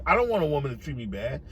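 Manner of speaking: ordinary delivery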